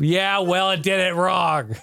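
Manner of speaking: slow, deadpan punchline; dry retort. Retold or imitated. Answering wife's pity for cable, deadpan slow retort. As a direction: land it flatly and slowly. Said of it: "retort to the wife's line".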